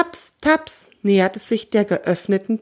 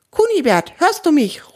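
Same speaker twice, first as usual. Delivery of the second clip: louder, higher call into the mic; calling loudly; 7 semitones higher, 4 dB louder than usual. A narrator's reading. Theo calls his friend over the radio, loud and urgent. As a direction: call as loud as you can. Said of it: "ruft er so laut er kann".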